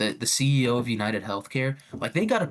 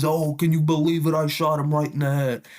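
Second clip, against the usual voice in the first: higher, louder mock-scandalized gossip; 4 semitones higher, 4 dB louder than usual. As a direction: blab it to the stream, hammed up.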